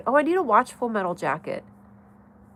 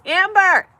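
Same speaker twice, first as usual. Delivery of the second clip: excited call; 10 semitones higher, 8 dB louder than usual. Real, not acted. Calling out to friend in chat.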